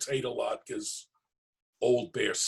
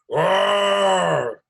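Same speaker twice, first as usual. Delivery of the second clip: bear growl; 8 semitones higher, 10 dB louder than usual. Acted.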